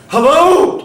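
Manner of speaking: loud, high searching shout; calling out. Acted.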